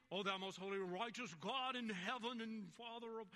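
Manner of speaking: loud, high, lofty church prayer; imitating pompous prayer. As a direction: boom out a mock-pious old-style prayer. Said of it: "Well, do I imitate".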